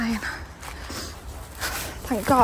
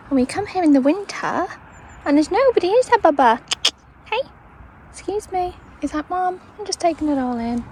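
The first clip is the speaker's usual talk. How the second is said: pet voice; 7 semitones higher, 3 dB louder than usual. Real, not acted. Talking to dog.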